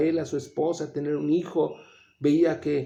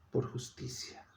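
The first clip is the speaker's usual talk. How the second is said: whispered; 13 dB quieter than usual. Acted. Preacher reading the verse to the church, whispered. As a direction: breathe the words out in a whisper.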